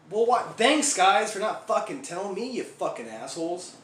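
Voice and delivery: loud sarcastic snap at his buddies, then it trails off; angry sarcastic thanks. Retold or imitated. Biker snaps sarcastically at his laughing gang members. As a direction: throw a sneering thank-you back at them.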